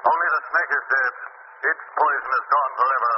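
high, intoning, insistent; will him to believe; commanding Shadow voice. Acted. The Shadow commanding Bill's mind, hypnotic.

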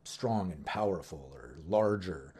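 low, strong big-person voice; deep demo voice for a large character. Shown as a demonstration. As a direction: drop way down, powerful and large. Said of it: "they just speak like this".

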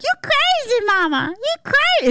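very high, squealed mock baby talk; imagined baby voice. Baby in the back seat to mama, high comic baby voice. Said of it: "The baby's probably like".

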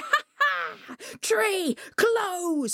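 high, gleeful cry with a laugh first. A narrator's reading. Coyote commanding the tree from the hole, high crying out. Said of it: "he cried".

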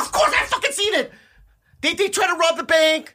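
very high, indignant yelp; mock outrage. Insisting to a doubting friend, mock outrage. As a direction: protest in a high put-on voice.

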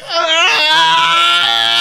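loud, high infant wail; mimicked baby's long shrieking cry; imitated baby crying. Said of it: "the baby starts crying hard".

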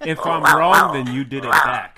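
too close to the mic, distorted; blasting into the mic; clipping distorted mic. Real, not acted. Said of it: "stop screaming into the mic".

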